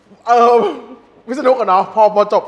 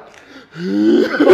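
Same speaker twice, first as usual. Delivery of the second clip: laugh; 2 semitones higher, 8 dB louder than usual. Real, not acted. Laughing about the cinema scene.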